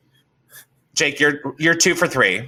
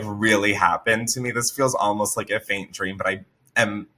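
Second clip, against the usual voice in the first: normal talking voice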